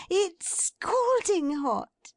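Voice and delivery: high, slow, gleeful exclaim; gleeful Meg. A narrator's reading. Meg gloats happily over the hot basket. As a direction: savour each word with delight. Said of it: "cried Meg".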